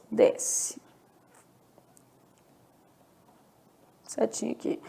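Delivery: whispered note to self; whispered aside. Real, not acted. Teacher whispering to herself while drawing an arrow. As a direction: whisper it to yourself while writing.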